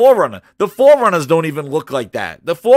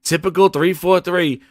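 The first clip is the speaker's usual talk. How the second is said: ordinary delivery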